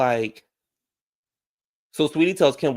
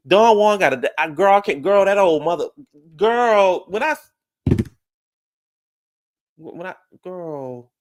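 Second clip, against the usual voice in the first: exasperated outburst; 6 semitones higher, 6 dB louder than usual. Real, not acted. Exasperated outburst about Don Juan.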